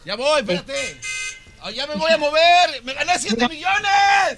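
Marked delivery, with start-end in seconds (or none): 1.6-4.4 high, stretched-out boast to the world; real jubilant outburst; exultant yelling. Real, not acted. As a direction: holler it at honking drivers, drawing out the end. Yells at honking cars.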